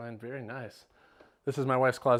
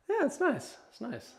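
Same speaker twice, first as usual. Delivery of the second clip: squeaky high voice; 13 semitones higher, slower than usual. Pretend. Joking high voice about toilet.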